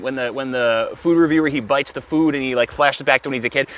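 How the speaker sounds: normal talking voice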